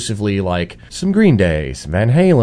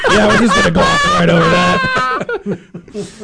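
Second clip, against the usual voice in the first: high-pitched, laughing through the words; laughing admission; 12 semitones higher than usual. Real, not acted. Guest caught glossing over his brass band past, laughing. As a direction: own up while cracking up.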